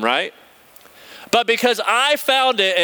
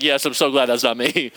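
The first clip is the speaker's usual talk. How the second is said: relieved laugh; 7 semitones lower than usual. Retold or imitated. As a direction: chuckle out a guilty relief. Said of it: "I was like".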